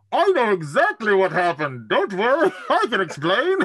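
Brewer's voice. Acted. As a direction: Brewer bursts out of the room, loud and high, chuckling. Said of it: "you hear this voice going".

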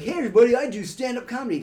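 high, loud mock announcement; eager self-introduction. Comic mocking himself as a new hire, mock-eager announcement. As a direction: announce yourself brightly to the room, hammed up. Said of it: "I didn't go in there like".

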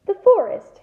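high, loud startled outburst. A narrator's reading. Boy hearing they must enter the forest, rattled. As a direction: lose your cool on the word. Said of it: "didn't sound quite as cool as usual".

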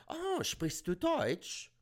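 slow high German question; mock the woman asking in German. Woman at open mic asks in German, slow and high. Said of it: "we started speaking German".

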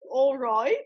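attempted London accent, rising at the end; imitated London accent. Host repeats the London guest's phrase, attempted London accent. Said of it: "Can we recreate the accent?".

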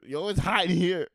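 high, loud oven voice; the oven shouts it's hot; talking oven. Acted. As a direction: oven complains loudly. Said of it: "It, it says".

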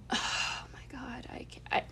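breathy, barely voiced sigh of disbelief; overwhelmed. Real, not acted. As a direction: sigh it out, too moved to finish. Overcome recalling how sweet she was.

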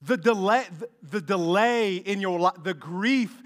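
loud, high preaching cry; full-force preaching. Acted. Preacher urging the congregation on the delay, loud preaching. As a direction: preach it out to the whole room.

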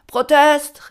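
high, loud heckle; shouted objection. A narrator's reading. Someone objects from the back, high shout. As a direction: yell it from the back rows. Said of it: "rief jemand aus den hinteren Reihen".

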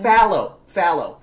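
"Fallow" as FAL-oh, first one loud; demonstrated pronunciation. Shown as a demonstration. Proving the vowel to co-host, "Fallow" with cat A. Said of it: "It's pronounced fallow".

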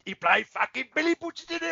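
high, hammed-up mock Cockney; mocking a terrible Cockney accent; bad Cockney accent. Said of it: "really, really bad accent".

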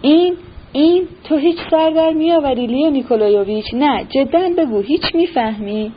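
a flustered general losing his temper; angry outburst. A narrator's reading. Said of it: "با عصبانیت گفت".

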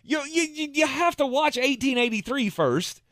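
high-pitched insistent pleading; insistent protest. Real, not acted. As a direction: push the point, voice pitched right up. Co-host argues watch order.

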